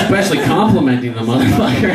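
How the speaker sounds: ordinary talk, nothing marked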